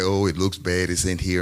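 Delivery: usual speaking voice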